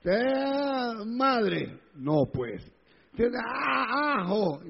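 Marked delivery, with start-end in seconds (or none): 0.0-1.8 mock swearing, drawn out and higher; imitated swearing. Preacher mimics forbidden swear words to congregation, mock cursing. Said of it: "ni tampoco la madre". 3.2-4.6 mock curse with a long, high opening; imitated swearing. Preacher mimics a banned expletive for congregation, mock cursing. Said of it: "ni el ajo".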